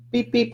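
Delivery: high playful beep beep like a toy horn; bus horn beep. Pretend. Drawing a bus for viewers, playful high horn noise. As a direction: toot it like a cartoon bus.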